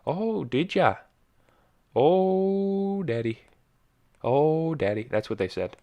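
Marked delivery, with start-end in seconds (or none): 0.1-1.0 a light Minnesota accent, sing-song Minnesota "oh yeah"; chirpy small-talk in a Minnesota accent; friendly Minnesota neighbor accent. Shown as a demonstration. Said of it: "everyone talks like this". 2.0-5.1 high "Oh" in a Minnesota accent, then a dropped "daddy"; mock-swooning Minnesota accent. Shown as a demonstration. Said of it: "everyone talks like this".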